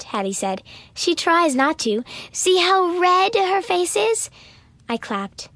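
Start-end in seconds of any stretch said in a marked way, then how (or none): 0.9-4.3 high, slow mocking tease; gloating. A narrator's reading. Hattie mocking Ella's red face, high and slow. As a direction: savour her struggle.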